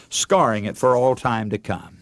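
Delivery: ordinary talk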